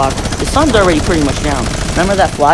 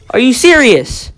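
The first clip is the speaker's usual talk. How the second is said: indignant disbelief; 8 semitones higher, 6 dB louder, slower than usual. Real, not acted. Indignant at parents not knowing.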